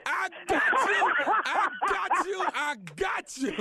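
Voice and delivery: loud, high, laughing gloat; laughing triumph. Real, not acted. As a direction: crow with laughter that you got them. Prank reveal, shouting and laughing.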